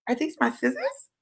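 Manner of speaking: quiet creepy calm, spaced by pauses; calm crazy. Retold or imitated. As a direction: play innocent with eerie softness. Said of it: "calm, crazy voice".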